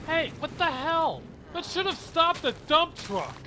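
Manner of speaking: high, indignant exclamation; indignant outburst. Acted. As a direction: raise the pitch in startled outrage. Indignant at a crash.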